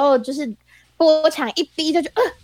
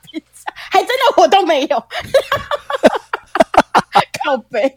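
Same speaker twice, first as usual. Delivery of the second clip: laughing; 4 semitones higher, 5 dB louder than usual. Real, not acted. Laughing at own joke.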